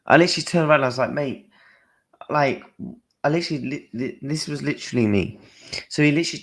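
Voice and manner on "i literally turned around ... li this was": fast, higher and louder; racing through the moment he turned round on the fan; excited rush. Real, not acted. Telling viewers how he spun round on the fan, excited and rushed.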